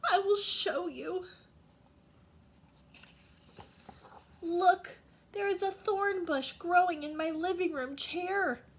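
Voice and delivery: put-on old lady leads the policeman to her chair. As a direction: show it off, a touch upset. Old lady shows the thorn bush.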